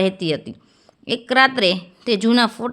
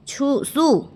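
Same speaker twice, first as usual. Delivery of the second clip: higher-pitched puzzled question; taken by surprise, voice going up; surprised; 4 semitones higher than usual. A narrator's reading. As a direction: ask in mild astonishment, pitch lifted. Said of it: "કાંતાએ આશ્ચર્યથી પૂછ્યું".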